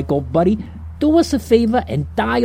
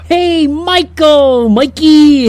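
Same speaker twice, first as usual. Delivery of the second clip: loud, drawn-out hail across the street; hammy buddy greeting yelled across the way; calling out; 6 semitones higher, 5 dB louder, much slower than usual. Acted. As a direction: shout his name to get his attention. Greeting the angel from afar.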